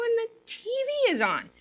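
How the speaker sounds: high-pitched retold disbelief; mock astonishment. Finding the TV on at night, astonished. Retelling her discovery of TV.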